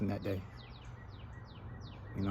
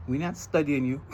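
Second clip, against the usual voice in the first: the Trinidadian saying "We're not studying you" in a Trinidad lilt, raised; Trinidadian accent; 3 semitones higher than usual. Shown as a demonstration. Man showing how in Trinidad they dismiss someone: Trinidadian accent. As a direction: say it like a Trinidadian islander would. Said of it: "In Trinidad, we say".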